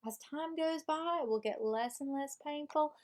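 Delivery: lively Southern self-help character; Southern accent. Pretend. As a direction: read it out in a put-on Southern accent. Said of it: "I'm reading this with a Southern accent".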